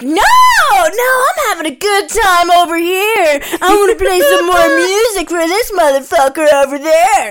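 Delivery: high, loud mocking impression of the guy. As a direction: ham up his clueless excuse. Mocking the guy's reply.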